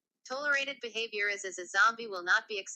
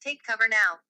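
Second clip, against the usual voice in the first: loud, firm alarm call; urgent warning; 8 dB louder than usual. Acted. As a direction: order people to shelter now. Urgent emergency alert warning.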